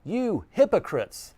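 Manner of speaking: preacher shouting You hypocrites; emphatic rebuke. Shown as a demonstration. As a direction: hurl You hypocrites at the crowd like Jesus. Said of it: "read it the way that it was delivered by Jesus".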